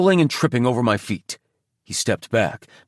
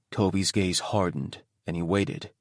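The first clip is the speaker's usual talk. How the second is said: plain reading voice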